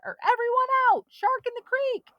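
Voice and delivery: high, loud, fast barked warning; captain shouting from a motorboat; shouted alarm. A narrator's reading. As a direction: clear the water with an alarm. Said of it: "Captain Wilson's voice shouting".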